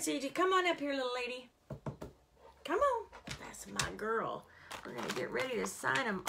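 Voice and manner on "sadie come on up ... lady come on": higher sing-song call to a pet; calling the dog. Real, not acted. Owner calling her dog Sadie up, higher sing-song. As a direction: coax the little dog up.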